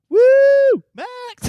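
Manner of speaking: high, excited "woo" and cheer; mock-cheer like a thrilled mum at the podium; imitated cheering mum. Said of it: "she's literally there being like".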